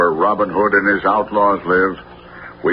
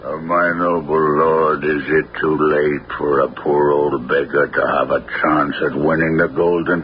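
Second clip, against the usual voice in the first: put-on lower, humble old beggar; 6 semitones lower than usual. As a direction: play a poor old beggar, pitched lower. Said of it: "dressed as a beggar with a patch".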